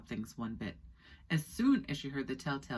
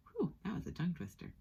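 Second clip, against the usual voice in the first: plain reading voice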